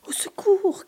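high, desperate cry; cry for help. A narrator's reading. Brady squashed in the hammock, desperate high cry for help. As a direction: call out as if suffocating. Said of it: "crie-t-il".

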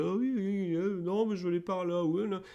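put-on high-pitched caricature of a drunk; drunk person. Improv teacher demonstrating the drunk character to avoid, high mock-drunk voice. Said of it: "parce que quelqu'un de bourré, bah, si tu le joues".

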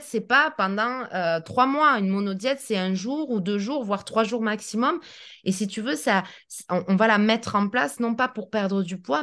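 usual speaking voice

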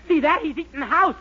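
loud and high, bluffing fast; frantic exclamation. Acted. Dennis covering the empty-lot slip to the doctor, loud fake alarm.

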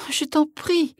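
very high, imploring voice; pleading mother wolf. A narrator's reading. Mother wolf implores hunter to spare cubs, high and pleading. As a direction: beg for mercy in a high voice. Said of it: "le regardait d'un air suppliant".

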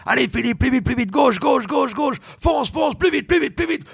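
high, rising urgent coaching calls; coaching swimmer. Retold or imitated. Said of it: "Il est là".

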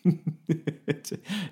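self-satisfied chuckle; chuckling at own pun. Acted. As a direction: laugh at your own joke. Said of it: "Il rit de son petit jeu de mots".

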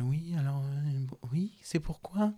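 high, slow, breathy whisper; whispered polite answer. Retold or imitated. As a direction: answer softly so the father won't hear. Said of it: "il arrive, il fait".

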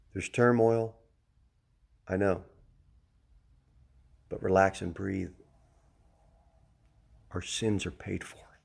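low, soft, unhurried with long pauses; calm reassurance. Shown as a demonstration. Preacher telling flock to relax and breathe, calmly reassuring. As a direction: settle them down gently, pitched low. Models relaxing, sins paid for.

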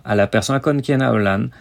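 usual speaking voice

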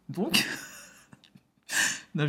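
a breathy little laugh breaking through; laughing softly. Real, not acted. Telling viewers her story sounds mad, laughing quietly. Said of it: "je rigole parce que je me dis".

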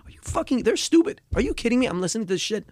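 high, exasperated ranting to himself; angry disbelief. Retold or imitated. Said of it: "I kept on saying that to myself".

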